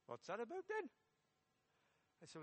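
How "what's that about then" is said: higher-pitched Welsh-Geordie student; Welsh Geordie accent. Acted. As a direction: ask it suspiciously in Welsh-tinged Geordie. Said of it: "a Welsh Geordie accent".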